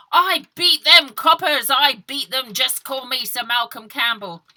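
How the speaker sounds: loud, high triumphant bellow; triumphant shout. Acted. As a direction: roar it out, fists in the air. Leonard punching the air.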